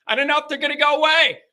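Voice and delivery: high, quick, hammed-up panic; mock-panicked high squeal of worry; anxious outburst. Repeats his anxiety, hammed up.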